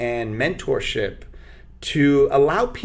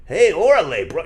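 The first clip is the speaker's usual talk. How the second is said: fake Mexican accent; 4 semitones higher, 6 dB louder than usual. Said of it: "I don't go like".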